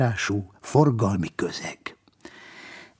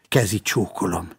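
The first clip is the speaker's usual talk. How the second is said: usual speaking voice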